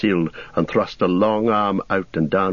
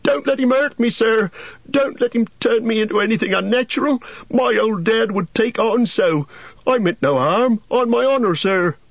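much higher, pleading cry; frightened Sam. A narrator's reading. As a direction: beg for help, panicked. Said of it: "cried Sam, quaking".

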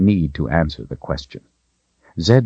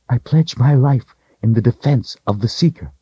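lightly voiced woman, higher pitch; kneeling woman's pledge; 6 semitones higher than usual. A narrator's reading. Kaelin kneels before Richard, higher-pitched solemn pledge.

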